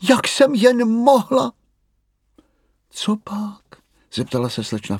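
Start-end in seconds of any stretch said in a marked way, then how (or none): 0.1-1.5 hlasitě a mnohem výš vykřiknout; zděšený výkřik. Acted. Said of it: "vykřikla". 2.9-3.8 šeptem. Acted. Slečna Ferka vyskočí.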